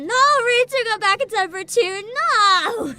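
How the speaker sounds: high, loud wail of no; loud, dismayed protest; protesting cry. Real, not acted. Crying out in protest.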